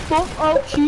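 normal talking voice

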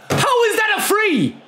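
very high indignant yell; outraged shout. Real, not acted. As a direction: shout at the ref in disbelief. Outraged at referee call.

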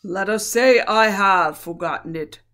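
solemn royal command; grave lion voice. A narrator's reading. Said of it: "answered Aslan gravely".

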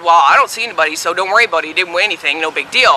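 low, casual shrug in the voice; retold dismissal. Winner retelling her reply to Tom, casually dismissive. Retells brushing off the phone warning.

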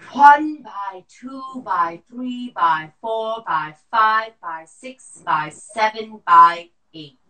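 slow loud forced count; lung function test. Shown as a demonstration. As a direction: push the breath out counting. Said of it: "breath count drill".

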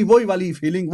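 ordinary talk, nothing marked

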